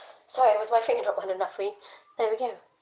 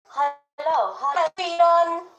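high, drawn-out hello; playful greeting; 7 semitones higher, slower than usual. Pretend. Streamer saying hi to everyone, high and stretched out. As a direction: sing out the greeting high and slow. Greeting, joked as mock accent.

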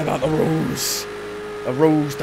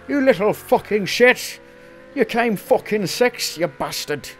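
angry mock-Scottish telling-off, higher at the start; Scottish accent; 5 semitones higher than usual. Imagined team boss berating the driver for coming sixth, Scottish accent. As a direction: scold in a put-on Scottish accent. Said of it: "is that Scottish accent? I don't know why he'd be Scottish".

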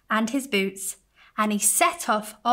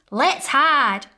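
put-on louder, eager kid's idea; a child plotting a game; Cowboy Baby; 4 semitones higher, 7 dB louder than usual. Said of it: "said Cowboy Baby".

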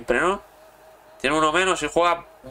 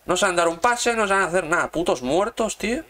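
angry rant; 7 semitones higher than usual. Real, not acted. Gamer scolding his useless players, angry higher rant. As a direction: berate them like they're useless.

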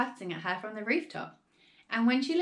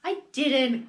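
lightly voiced crone, raised; a hint of a gleeful cackle; cackling old woman; 4 semitones higher, 6 dB louder than usual. A narrator's reading. Old woman answering the mother, lightly cackling raised voice. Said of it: "cackled the old woman".

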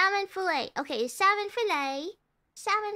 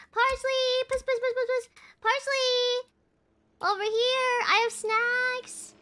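higher, coaxing pet call; calling a cat; 5 semitones higher than usual. Pretend. Player pretend-luring parsley with snacks, cat call. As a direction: lure it over like a cat, playfully.

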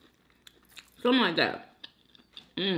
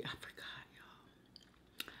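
whispered aside; 26 dB quieter, much slower than usual. Real, not acted. Forgetful aside to viewers.

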